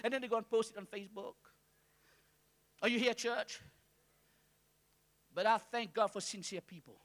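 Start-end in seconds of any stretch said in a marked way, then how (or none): none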